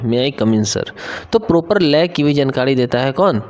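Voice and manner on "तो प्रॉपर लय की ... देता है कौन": usual speaking voice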